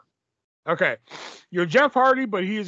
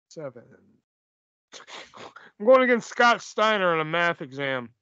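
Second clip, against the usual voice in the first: ordinary delivery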